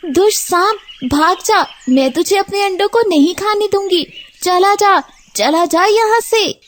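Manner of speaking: high, fast cry to drive the snake off; a mother bird yelling at an intruder; shouting at the snake. Acted. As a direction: bird shouts at the snake to leave her eggs. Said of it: "तो Tony उसे देखकर चिल्लाती है".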